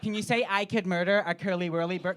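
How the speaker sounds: mock American accent; American tongue-twister request, cut off; Tyler. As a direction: keep pestering as an eager American. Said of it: "it's Americans asking me".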